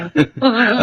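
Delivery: high villain's cackle; evil laugh. Acted. Reden laughs over inheriting everything, evil cackle. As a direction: let out a scheming cackle.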